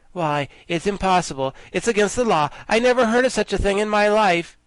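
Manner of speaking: indignant, flustered disbelief; astounded. A narrator's reading. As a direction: protest in shock.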